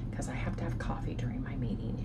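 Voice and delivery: soft whispered aside. Real, not acted. Vlogger whispers to viewers about needing meeting coffee.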